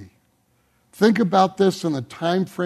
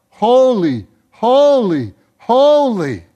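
crying out; 5 semitones higher, 6 dB louder, slower than usual. Acted. Living creatures crying out holy.

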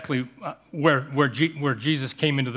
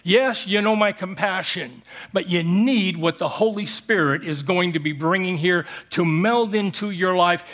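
ordinary delivery

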